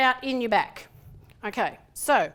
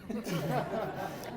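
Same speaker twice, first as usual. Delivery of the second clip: soft laugh; 3 semitones lower, 8 dB quieter than usual. Real, not acted. Laughs at own instruction.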